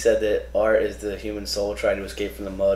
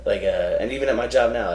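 normal talking voice

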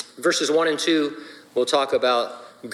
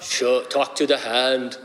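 Arnold impression; slower than usual. Pastor imitating Arnold for the congregation, slow and heavy. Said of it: "Arnold ... says what".

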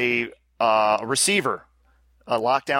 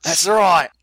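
loud, higher mock-Australian accent; terrible Australian accent; 4 semitones higher, 7 dB louder than usual. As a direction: belt out a bad Aussie accent. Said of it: "my terrible Australian accent".

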